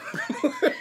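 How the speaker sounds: sudden high laugh bursting out; burst of laughter. Real, not acted. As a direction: laugh at the memory. Laughs at own story.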